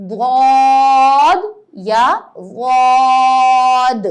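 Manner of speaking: ضاد then غاد, each long and loud; two ways of daad. Shown as a demonstration. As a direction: stretch ضاد with the tongue to the side. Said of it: "اس کو ہم پڑھتے ہیں".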